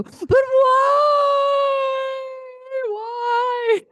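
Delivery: high, loud shouted whine; whiny girl. Retold or imitated. Girl whining about a meal plan, high loud shout. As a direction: wail the question like a sulking girl. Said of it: "girls go".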